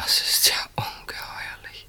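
breathless whispered outrage; shocked whisper. Acted. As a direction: whisper it, appalled. Shocked by the accusation. (entsetztes Flüstern)

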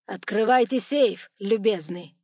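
loud, brusque demand; slow, loud, discourteous command; curt, uncivil. A narrator's reading. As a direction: order with all courtesy dropped. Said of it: "сказал он уже без всякой учтивости в голосе".

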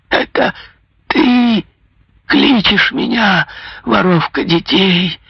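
high, slow rasping curse; hoarse, dying-rattle curse; death rattle. A narrator's reading. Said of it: "голосом, походившим на предсмертное хрипение".

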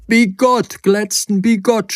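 high, loud indignant cry; doctor shouts at Nagel's claim; shouted outrage. A narrator's reading. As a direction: shout the word back in disbelief. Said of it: "schrie der Doktor".

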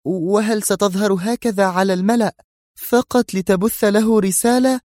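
high woman's voice, incredulous; shocked disapproval. Acted. Zosha questioning Yuri in disapproving shock, high. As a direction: protest in disbelief. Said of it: "في ذهول مستنكر".

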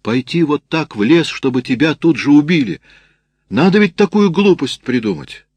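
high-pitched, worked-up telling-off; indignant scolding. A narrator's reading. Canteen woman berating someone for going into the woods, indignant scolding. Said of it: "маркетантка всё не унималась".

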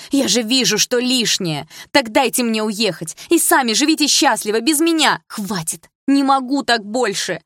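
loud, high, slow screams; screaming outburst. A narrator's reading. Daughter screaming at her family, breaking down. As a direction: let everything burst out.

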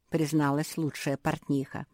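usual speaking voice

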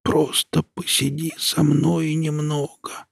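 barely audible, high, slow whisper; old woman whispering. A narrator's reading. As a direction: breathe it out, hardly there. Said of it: "еле слышно прошептала бабушка".

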